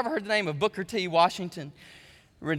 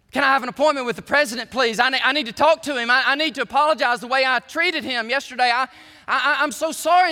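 fast, louder, higher frantic apology; mortified pleading; 6 semitones higher, 8 dB louder than usual. Retold or imitated. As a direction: beg forgiveness in a rush, stumbling. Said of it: "she was mortified".

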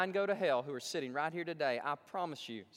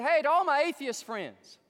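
loud, higher pitched pitchman opening; radio ad voice; 9 semitones higher, 10 dB louder than usual. Retold or imitated. Preacher mimicking a godless radio commercial, loud pitchman. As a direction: hype it like a radio announcer. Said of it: "He said".

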